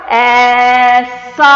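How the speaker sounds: ऐसा drawn out slowly, teacher model; teacher models ऐसा slowly for the class; careful aisa. Shown as a demonstration. Teacher drilling a child on ऐसा, slow and loud. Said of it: "फिर से बोलो".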